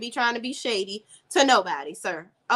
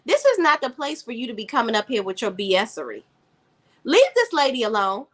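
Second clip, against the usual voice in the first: normal talking voice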